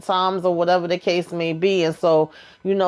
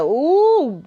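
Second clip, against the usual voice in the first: high, louder drawn-out ooh; gleeful ooh; 6 semitones higher, 3 dB louder than usual. Retold or imitated. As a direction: savour the drama with a rising ooh. Own reaction to a low blow.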